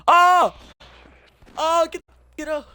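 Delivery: high, loud panicked scream; startled scream. Real, not acted. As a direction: shriek it as the rat jumps you. Jump scare from a rat.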